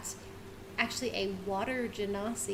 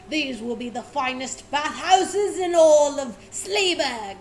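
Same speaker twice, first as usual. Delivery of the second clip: loud, slow proud declaration; proud dwarf boast; 4 semitones higher, 8 dB louder than usual. Acted. Dwarf pipes up boasting about the bathhouses, loud and proud. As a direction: puff up and boast grandly. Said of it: "he goes".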